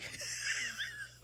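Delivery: squealing burst of laughter; high laugh. Real, not acted. Author laughing after telling the attendant she wrote it. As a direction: let out a high delighted laugh. Laughs at her own reveal.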